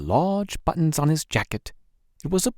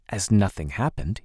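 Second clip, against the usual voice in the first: ordinary delivery, nothing marked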